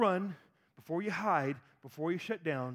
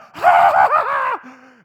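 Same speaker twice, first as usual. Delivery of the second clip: big real laugh, much louder; loud burst of laughter; 8 semitones higher, 18 dB louder than usual. Real, not acted. Pastor laughing at his son's story, loud laugh. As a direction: laugh out at the memory.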